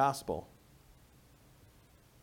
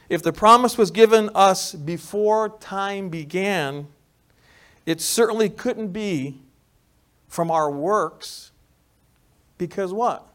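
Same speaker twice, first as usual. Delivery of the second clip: preaching full force; 7 semitones higher, 10 dB louder, slower than usual. Acted. Preaching climax.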